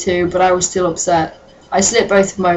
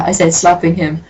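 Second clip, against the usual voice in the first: usual speaking voice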